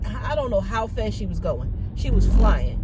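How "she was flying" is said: emphatic. Real, not acted. Exasperated about speeding driver.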